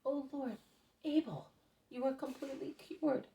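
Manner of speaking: joyful shout. Acted. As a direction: burst out in amazed delight. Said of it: "shouts out of joy".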